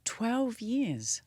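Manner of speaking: hushed, breathless disbelief; a shocked aside, barely voiced; stunned whisper. Real, not acted. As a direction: whisper it as if you can't believe it. Shocked reaction to twelve-year diagnosis.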